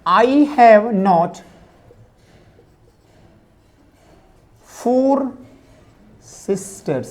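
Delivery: every word stretched with long gaps; slow dictation. Shown as a demonstration. Teacher dictating the basic negative to students, very slow. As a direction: dictate the English sentence very slowly. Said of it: "तो इसके according क्या करेंगे".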